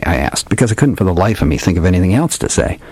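usual speaking voice

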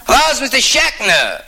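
high-pitched caller's voice; Mister Schechner calling. Acted. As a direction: put on a high voice for the phone caller. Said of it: "the phone rings".

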